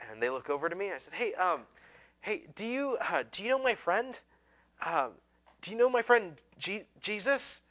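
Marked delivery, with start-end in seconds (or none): 1.1-7.6 high, hesitant eager question; nervous first-time evangelist, raised pitch; awkward eager asking. Retold or imitated. Student asks passing strangers about Jesus, awkward and eager.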